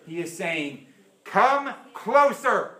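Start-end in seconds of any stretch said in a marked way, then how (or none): 1.2-2.8 slow, loud and drawn out; insistent invitation. Acted. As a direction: call them in slowly. God's invitation.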